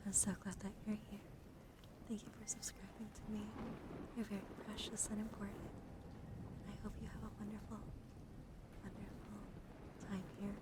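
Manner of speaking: hushed, soothing, near-whispered delivery; ASMR soft speaking. Real, not acted. Streamer spelling and thanking a new subscriber, soft ASMR. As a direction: soothe the listener, softly and gently.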